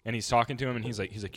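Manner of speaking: ordinary talk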